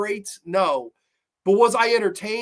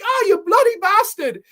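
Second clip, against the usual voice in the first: high, loud British outrage; hammed-up British cursing; bad British accent; 13 semitones higher, 7 dB louder than usual. Woman just grazed by a bullet, mock-outraged British accent. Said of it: "I don't do a good British accent".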